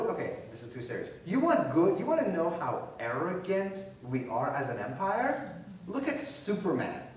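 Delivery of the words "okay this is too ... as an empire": ordinary talk, nothing marked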